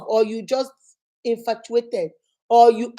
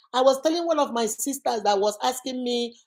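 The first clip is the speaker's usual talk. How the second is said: ordinary talk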